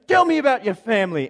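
high, loud demand in a put-on accent; Charles Siegel. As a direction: insist eagerly in an exaggerated accent. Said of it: "got this incredible accent".

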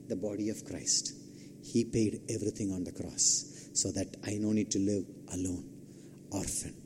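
low, soft prayerful delivery with pauses; quiet communion reflection. Real, not acted. Preacher leading the congregation into communion, quiet reverent reflection. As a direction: guide them softly and low into communion.

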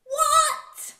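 loud, high shriek of surprise; appalled disbelief. Acted. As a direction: explode in mock outrage. Appalled at the silly song.

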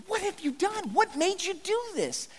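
high, hushed, slowed disbelief; a bewildered, hurt demand; stunned Moses. Acted. Moses asking Aaron what made him do this, stunned. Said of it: "he says, basically".

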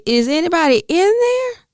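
high, exasperated is anybody in there; mock knocking. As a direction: call into her head like knocking on a door. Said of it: "knock on her forehead and say".

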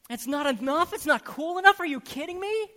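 loud, high sarcastic scoff; mock scorn. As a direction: scoff at the complaint. Mocking the need for novelty.

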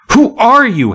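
high, loud shriek. A narrator's reading. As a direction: cry out in terror at the shadow. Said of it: "Hannah shrieked".

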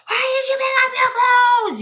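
put-on a parent screaming at a child, very high; high shrieking yell. Mother screaming at daughter about clothes, high shriek. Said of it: "all the yelling and screaming".

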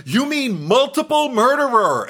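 high-pitched, drawn-out indignant correction; slow it down and pitch it way up, aghast; Jesse's voice. Acted. Correcting someone who said mass murderer, high mock-indignant. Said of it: "I can actually hear it in Jesse's voice".

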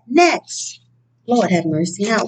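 ordinary delivery

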